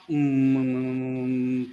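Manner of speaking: long, low, steady "mmm"; continuous hum. Retold or imitated. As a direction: imitate an unbroken drone of humming. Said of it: "he hears one hum".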